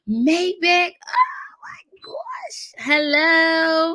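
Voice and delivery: gasping, high-pitched delighted hello; thrilled greeting. Real, not acted. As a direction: squeal with excitement as someone arrives. Greets a surprise arrival.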